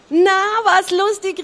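high, loud royal call. A narrator's reading. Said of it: "rief der König". (laut und hoch gerufen)

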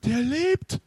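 high, alarmed yell; frightened cry. A narrator's reading. Sven sees the mummy staring, high panicked cry. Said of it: "Sven schrie".

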